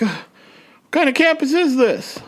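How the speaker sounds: high, incredulous cry; lost student staring at an alleyway, baffled; upset disbelief. Retold or imitated. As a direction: ask it in outraged disbelief. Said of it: "I was upset".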